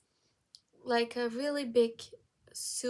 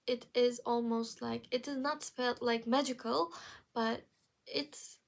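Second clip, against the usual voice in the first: ordinary delivery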